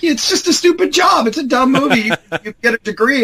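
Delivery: mocking. Two mentors railing at the young applicant, high mocking voice. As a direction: scoff at the kid like he's a fool. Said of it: "just railed on me like".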